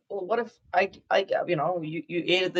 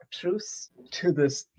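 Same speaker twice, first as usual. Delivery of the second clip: very slow, drawn-out truce; slow solemn offer; 4 semitones higher, much slower than usual. Acted. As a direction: offer the truce slowly and grandly. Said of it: "I say".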